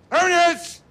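high commanding shout; barking order. Acted. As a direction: bark like a drill officer. Kaban shouts drill commands.

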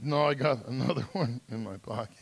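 slightly tearful kid, lightly imitated; sheepish confession. Hooked kid admitting a backup vape, sheepish. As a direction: own up haltingly in a small voice.

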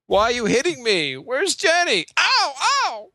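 high, slow Southern drawl; whiny Forrest Gump impression. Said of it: "Forrest Gump".

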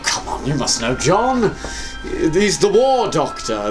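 ordinary delivery

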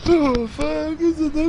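high, tearful laugh-cry; laughing panic. Real, not acted. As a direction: blurt it out in a helpless, high-pitched panic. Panicked players in helpless laughing fit.

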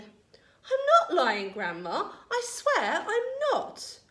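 put-on high, loud, drawn-out boy's denial; pleading protest. A narrator's reading. George swearing to Grandma he is honest, pleading protest. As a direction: protest loudly, rising high at the end.

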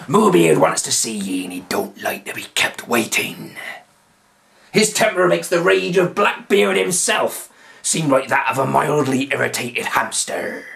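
hushed, menacing pirate voice; pirate warning prisoners about his chief. A narrator's reading. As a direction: lean in and threaten. Pirate warns of Moobeard.

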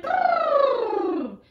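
very high buzzing elephant call; elephant trumpet. Shown as a demonstration. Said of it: "Trumpeting like an elephant?".

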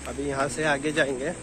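ordinary talk

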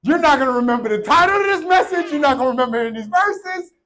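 high, loud pulpit barking; demonstrative preacher's shout. Shown as a demonstration. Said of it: "Why is he barking at me?".

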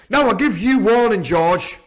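loud, high pirate threat; crying out a warning. A narrator's reading. Silver springs up to warn George, crying out. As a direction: shout a warning as an angry sea cook. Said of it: "he cried".

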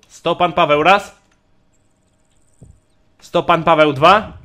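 loud rising auction call; auctioneer. Acted. Calling bids louder.

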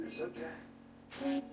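whispered, slow, through tears; a choked, tearful "It's okay". Retold or imitated. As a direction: barely voice it while crying. Young man crying in confession.

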